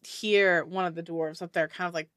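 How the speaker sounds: normal talking voice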